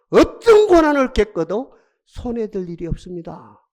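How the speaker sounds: ordinary delivery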